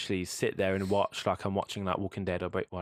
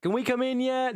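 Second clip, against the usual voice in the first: much higher, excited put-on voice; eager friends peeking in; 4 dB louder than usual. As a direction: squeak it like kids begging to come in. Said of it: "they were like".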